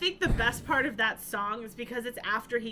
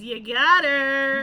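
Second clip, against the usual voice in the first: slow, louder self-outing; playful slow admission; mock confession; 9 dB louder, much slower than usual. Real, not acted. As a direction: drag it out proudly. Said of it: "who's obsessed with Lord of the Rings".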